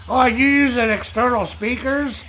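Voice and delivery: high mock old-man whine; squeaky imitation of the old guy; old guy impression. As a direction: mimic the old gamer's high voice. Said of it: "he's like".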